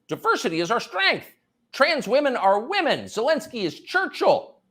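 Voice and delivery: high, emphatic parroting of slogans; hammed-up, high-pitched earnestness; mock sloganeering. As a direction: chant it like a mocked true believer.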